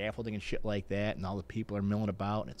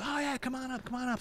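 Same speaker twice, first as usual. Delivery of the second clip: high-pitched imitated call-over; calling someone up. Race organizer calling the mayor up to the podium, high mock call. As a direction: beckon him up in a much higher voice.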